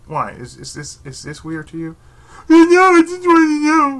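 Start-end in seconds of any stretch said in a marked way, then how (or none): 2.5-4.0 high, loud squeaky mock voice; mock Facebook poster. Vlogger mocking Facebook posters in a high squeal. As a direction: parody a clueless poster, pitched way up.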